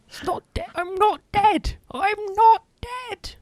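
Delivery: high squeaky Monty Python voice; Monty Python plague victim. Retold or imitated. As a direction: squeak it like the not-dead man. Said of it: "Monty Python references".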